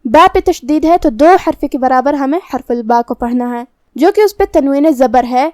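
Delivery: ordinary delivery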